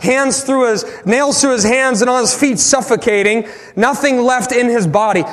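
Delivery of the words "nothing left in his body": ordinary talk, nothing marked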